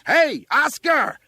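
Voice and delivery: slow, high, very loud call; winded fireman's yell. A narrator's reading. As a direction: holler up to catch his attention. Fireman yells up at boy.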